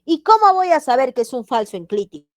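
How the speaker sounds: loud, high-pitched student query; acted student's question. Voices a student's puzzled question.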